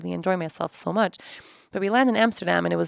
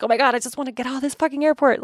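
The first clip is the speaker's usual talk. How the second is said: panicked; 6 semitones higher, 4 dB louder than usual. Retold or imitated. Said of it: "a little bit panicked".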